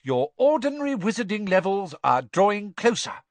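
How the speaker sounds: high, loud schoolmistress warning; McGonagall. A narrator's reading. Professor warning fourth years about exams, high and stern. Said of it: "she told them, her eyes glinting dangerously".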